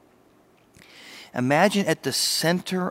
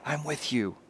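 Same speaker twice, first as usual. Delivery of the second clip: soft divine voice; 8 dB quieter than usual. Acted. God's voice speaking softly.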